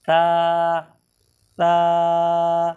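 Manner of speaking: ता said clean with no hiss; no-whistle ta. Shown as a demonstration. Teacher contrasting ता with the hissing letter, clean ta. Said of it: "बिल्कुल सीटी नहीं".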